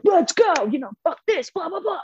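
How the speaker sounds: high-pitched mock taunting yell, not full volume; imitation of a yelling fighter. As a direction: goad him with a strained, hammed-up holler. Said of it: "he's yelling things like that".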